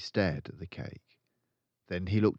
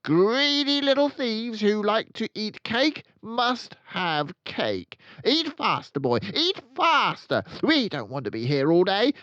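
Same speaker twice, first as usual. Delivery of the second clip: furious threats; 11 dB louder than usual. A narrator's reading. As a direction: threaten him with relish. Trunchbull threatening boy.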